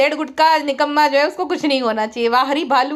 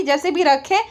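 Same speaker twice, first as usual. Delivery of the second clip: ordinary delivery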